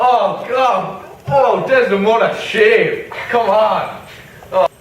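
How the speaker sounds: high, loud stage outburst; hammed-up exclaiming. Othello to his wife Desdemona, hammed-up exclaiming.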